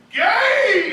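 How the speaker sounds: high, loud one-word squeal; mock shriek. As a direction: blurt it like a gleeful gotcha. Roommate blurts at blow dryer.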